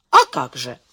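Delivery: low, self-satisfied confirmation. A narrator's reading. Hil confirms he has saved a life, self-satisfied. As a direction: answer smugly, pitched low. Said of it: "самодовольно кивнул Хиль".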